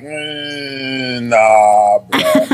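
low, drawn-out doubtful "mm, nah"; sceptical dismissal. Retold or imitated. Soldier brushing off people's stories, sceptical dismissal. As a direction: hum doubtfully, then drop low to dismiss it.

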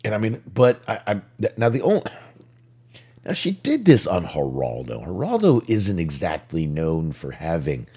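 ordinary talk, nothing marked

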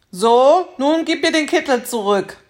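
lauter, höherer Ruf des Teufels; Teufel ruft. Acted. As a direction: fordernd wie der Teufel rufen. (lauter, fordernder Ruf des Teufels)

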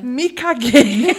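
drawn out slow laughing; slow laughing quote. Retold or imitated. Girl finding a stain, slow laughing shock. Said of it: "Eu falei".

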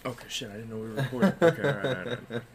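flustered laugh. Real, not acted. Surprised to be recorded.

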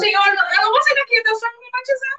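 high, insistent pleading to God; pleading prayer. Retold or imitated. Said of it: "Eu falava".

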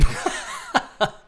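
short burst of laughter; laughing. Real, not acted. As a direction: laugh at the slip. Laughs at own voice crack.

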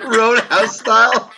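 high, slow and laughing through it; drawn-out laughing question. Real, not acted. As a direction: crack up while asking. Laughing at roundhouse joke.

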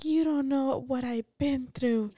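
high, slow mock whine of an overtired girl; whiny dramatic kid. Mom imitating her tired daughter, dramatic whine. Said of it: "she's like".

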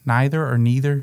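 "neither" first as NYE-ther, then as NEE-ther; contrasting pronunciations. Shown as a demonstration. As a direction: compare "neither" with eye sound, then ee sound. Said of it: "but not neither".

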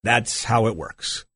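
low, quiet and smug; calm gloating sign-off; smug dismissal. Real, not acted. Host hangs up on caller.

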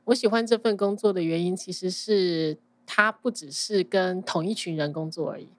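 ordinary delivery

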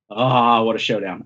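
ordinary delivery

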